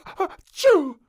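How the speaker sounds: high loud achoo; a sneeze that betrays her; sudden sneeze. A narrator's reading. Princess sneezes just after saying she isn't sick, loud achoo.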